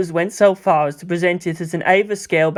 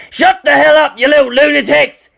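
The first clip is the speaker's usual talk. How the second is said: much higher, much louder angry yell; furious shout; 9 semitones higher, 7 dB louder than usual. A narrator's reading. Toji screaming at the unruly kids through a megaphone.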